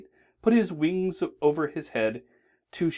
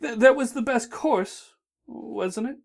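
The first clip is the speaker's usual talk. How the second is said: worried; 12 semitones higher, 4 dB louder than usual. A narrator's reading. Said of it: "a worried grin".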